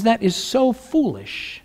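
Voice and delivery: a hint of God, soft and slow, "so" drawn out. God shakes his head at the wicked, soft and slow. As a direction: muse it softly, "so" lingering. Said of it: "God says".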